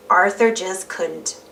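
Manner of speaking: usual speaking voice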